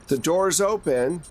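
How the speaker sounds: ordinary talk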